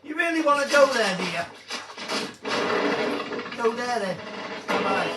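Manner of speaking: loud mocking dare; loud taunt. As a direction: shout it like a dare. Taunting in game.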